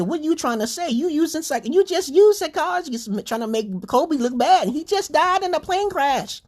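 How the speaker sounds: high, heated retelling of his own rant; retold outburst. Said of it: "I said".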